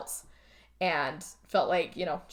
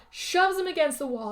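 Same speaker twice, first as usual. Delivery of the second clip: ordinary talk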